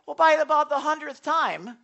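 normal talking voice

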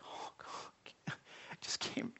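barely voiced, broken whisper of a weary prayer; desperate whisper. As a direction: breathe it out like someone too tired to pray.

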